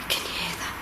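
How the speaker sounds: soft hushed whisper; real whisper. Real, not acted. Ghost hunter whispering as he creeps back in. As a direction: whisper so nothing hears.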